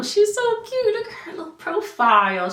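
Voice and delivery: high, gushing cute voice. Real, not acted. Showing the baby's profile, gushing high. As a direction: coo over the picture. Gushing over baby pictures.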